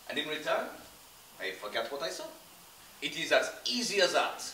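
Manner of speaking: a con man playing a Frenchman, French accent throughout; fake French accent. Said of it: "That doesn't sound very French, Pierre".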